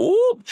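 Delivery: sudden high squeal. Real, not acted. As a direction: yelp as if catching himself. Nearly slipping into accent.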